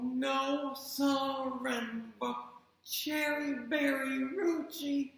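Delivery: each word drawn out, very slow; very slowly. Acted. Chang says the long name very slowly to mother. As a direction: spell the name out slowly and carefully. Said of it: "very slowly said".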